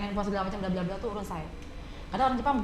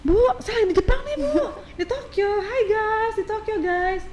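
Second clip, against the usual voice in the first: much higher, louder excited show-off; hammed-up loud vlogger; loud tourist video call; 11 semitones higher, 4 dB louder, slower than usual. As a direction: brag to mum and followers on camera. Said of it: "Kadang-kadang video call".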